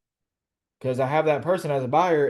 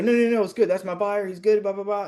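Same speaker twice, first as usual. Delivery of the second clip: mock insistent denial; 9 semitones higher than usual. Wholesaler keeps lying on the phone, high-pitched mock reassurance. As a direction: mimic a liar insisting it's all fine.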